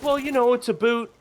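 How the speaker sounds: mock the mom saying "a boot" in a Canadian way; mimicking mother's Canadian accent. Imitating mother to her daughter, high mock Canadian accent. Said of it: "she's like".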